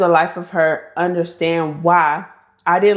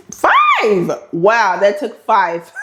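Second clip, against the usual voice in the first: real astonishment; 2 semitones higher, 3 dB louder, much slower than usual. Real, not acted. Vlogger realizing it actually took five years, astonished.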